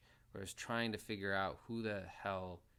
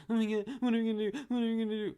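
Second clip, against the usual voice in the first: much higher, loud whiny panic; mocking whine; 13 semitones higher, 9 dB louder, much faster than usual. Mocking the irritating girl's panicked whining. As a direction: mimic her shrill, panicked whining. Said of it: "She's just whining and just".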